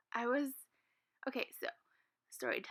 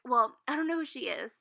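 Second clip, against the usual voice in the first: loud dismissive lady; 8 dB louder than usual. Retold or imitated.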